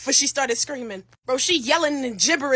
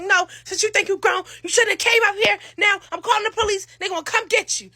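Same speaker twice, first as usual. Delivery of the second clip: higher voiced dad threat; a hint of an angry father; mock threatening father; 8 semitones higher than usual. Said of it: "He like".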